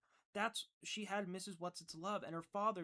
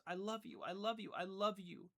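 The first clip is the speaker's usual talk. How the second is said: sobbing declaration; 4 semitones higher, 5 dB louder than usual. Acted. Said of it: "her sobs almost as loud as his".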